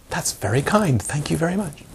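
soft, serene thanks; gentle thanks. Retold or imitated. Teacher answers an insult with soft, calm thanks.

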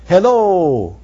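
loud stagey call; thespian voice. Shown as a demonstration. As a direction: project it like a stage actor. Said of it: "Watch this".

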